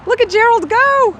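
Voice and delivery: high, thrilled exclamation; excited cheer. Real, not acted. Reader cheering giraffe on.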